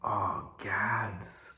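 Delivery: put-on hushed, breathy child's "Oh, gads"; little boy's exclamation. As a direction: whisper it like a toddler caught out. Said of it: "out of his mouth comes the phrase".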